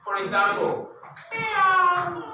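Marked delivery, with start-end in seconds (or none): 1.3-2.2 high drawn-out cat meow. Shown as a demonstration. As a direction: mew like a cat, high and long. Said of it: "when I make a sound".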